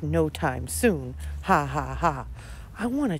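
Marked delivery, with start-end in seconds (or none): none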